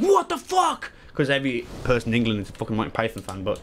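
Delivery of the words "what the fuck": high-pitched incredulous outburst; hammed-up disbelief; mock outrage. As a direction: imitate shocked fans, pitch shooting way up. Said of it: "a lot of people are like".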